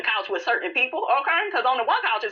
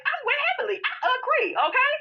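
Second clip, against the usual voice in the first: much higher, sing-song mock voice; prissy agreement. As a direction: chirp it like a prim cheerleader. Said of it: "And I'm like".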